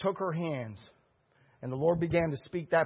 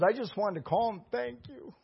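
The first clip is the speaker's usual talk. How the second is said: imitated tearful gratitude; 6 semitones higher than usual. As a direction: retell it as if close to tears. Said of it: "And he was crying".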